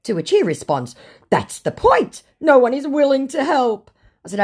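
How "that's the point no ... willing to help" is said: high, loud mock protest; hammed-up indignant outburst; exasperated scammer. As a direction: plead it loudly as the scammer. Voicing the scammer's reply.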